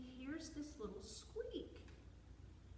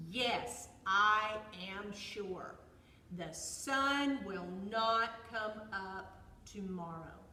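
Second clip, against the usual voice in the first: loud, slow, emphatic bear voice; insistent bear; 10 dB louder, slower than usual. A narrator's reading. Bear assures squirrel about tomorrow's sun, loud and adamant. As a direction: declare it loudly, word by slow word.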